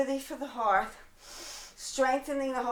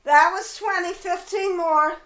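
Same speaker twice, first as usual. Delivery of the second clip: loud cheering count; 7 semitones higher, 10 dB louder than usual. Real, not acted. Fitness coach calling out to her class, loud and encouraging. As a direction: call out the count to pump them up.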